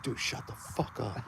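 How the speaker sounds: slow whispered shut-up; slow tense foxhole whisper; hissed whisper. Retold or imitated. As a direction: whisper it harshly to silence him. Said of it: "I said".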